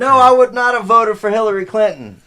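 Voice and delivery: loud, high-pitched protest; emphatic shout. Real, not acted. Guest denying he would vote Clinton, emphatic shout. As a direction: shout down the suggestion, real outburst. Protests over the host's teasing.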